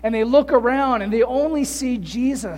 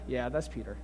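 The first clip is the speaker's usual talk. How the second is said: much lower and softer, half laughing; a dry knowing aside, voice dropped low; amused Jesus aside; 11 semitones lower, 9 dB quieter than usual. Retold or imitated. Jesus to Moses and Elijah about Peter, low amused aside. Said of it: "Jesus looking at Moses and Elijah like".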